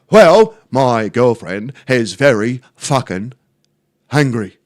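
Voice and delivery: a hammed-up, deadpan Terry. Neighbour Terry explaining his girlfriend's mood, slow dopey voice. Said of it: "And he goes".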